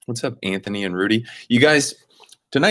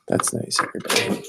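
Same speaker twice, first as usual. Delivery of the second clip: whispering; 5 dB quieter than usual. Real, not acted. Whispered aside to chat.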